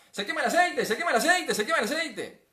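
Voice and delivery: very high, loud kitchen panic; panicked shouting. Acted. Someone panicking over burning oil, shouting. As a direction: yelp it repeatedly as if alarmed. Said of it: "la primera reacción es empezar a gritar".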